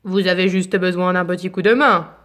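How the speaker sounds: a hint of a big lion offering help. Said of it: "dit Lion".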